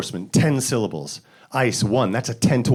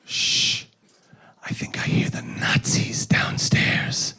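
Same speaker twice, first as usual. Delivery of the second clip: hushed, slow whisper; stage whisper; 4 dB quieter, much slower than usual. Retold or imitated. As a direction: whisper like hiding in the attic. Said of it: "one of the characters goes".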